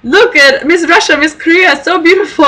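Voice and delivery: high, proud, calling others to look; showing-off old man. Retold or imitated. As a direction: show her off to the other passengers. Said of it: "he's like".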